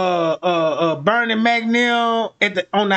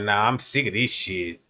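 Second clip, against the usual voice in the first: much lower, tired of it all; low fed-up man; 13 semitones lower, 5 dB quieter than usual. Retold or imitated.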